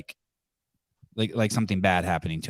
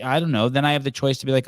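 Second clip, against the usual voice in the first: ordinary talk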